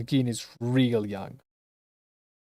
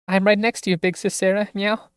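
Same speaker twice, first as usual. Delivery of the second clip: cute little sister; 5 semitones higher than usual. Acted.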